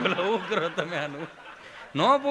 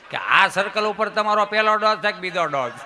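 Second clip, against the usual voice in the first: raised-voice mock questioning; emphatic complaint; 6 dB louder than usual. Patient mocking what police never ask, in a raised voice. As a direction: push the question out forcefully.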